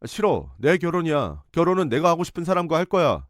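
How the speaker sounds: son defying his mother; mild mock yell. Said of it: "소리를 질렀어요".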